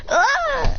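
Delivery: short high mock scream; high acted yelp of impact; cry on being hit. Sakura hit by the beam, high cry.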